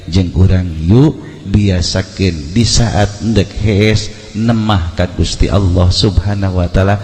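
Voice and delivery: usual speaking voice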